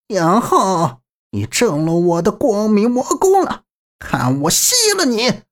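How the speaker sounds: high, gloating madwoman voice; triumphant and unhinged, going for the kill; crazed. A narrator's reading. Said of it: "好似陷入癫狂".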